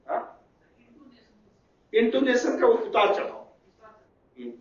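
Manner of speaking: normal talking voice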